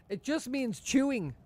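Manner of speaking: high, slow, stressed; slow emphatic explanation. Real, not acted. Explaining a word to chat, slow and high. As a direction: spell it out slowly for chat. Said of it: "I said mastication".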